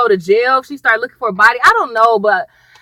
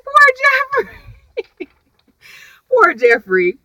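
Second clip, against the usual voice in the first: laughing pity; 11 semitones higher, slower than usual. Real, not acted. Pitying the man while laughing.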